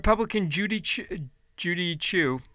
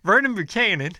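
Southern accent; 4 semitones higher, 5 dB louder than usual. Shown as a demonstration. Vlogger reading rich congressmen redoes the name in a Southern accent. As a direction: have a quick go at a Southern accent on Vernon Buchanan. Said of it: "I should have done it with a Southern accent".

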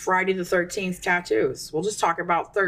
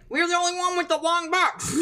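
mock company voice; 10 semitones higher than usual. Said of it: "They're like".

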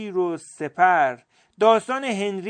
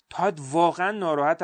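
plain reading voice

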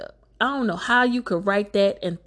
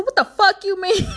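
high, loud yell of disbelief; acted-out incredulous shriek; outraged yell; 13 semitones higher, 6 dB louder than usual. Wife confronting husband over the money, outraged yell.

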